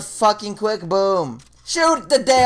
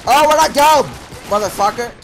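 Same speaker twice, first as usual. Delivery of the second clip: raging yell; 5 semitones higher, 6 dB louder than usual. Real, not acted. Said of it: "Hope you enjoyed my little rage".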